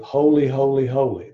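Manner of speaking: holy, holy, holy with rising weight; a mild proclaiming triple holy; emphatic holy. Retold or imitated. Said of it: "That's like saying an emphatic".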